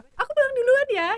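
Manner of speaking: high, hurried man's excuse; mock the date sneaking off; the date leaving. The date excusing himself, high and hurried. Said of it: "Tiba-tiba dia".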